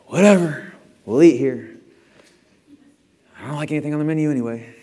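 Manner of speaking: mock pouting complaint at a restaurant; whiny sulk. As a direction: grumble like a pouting kid. Mocking a sulky diner.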